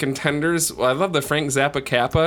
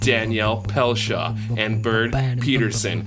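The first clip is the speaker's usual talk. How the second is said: ringmaster; 5 semitones lower, slower than usual. Acted. Awards show announcement.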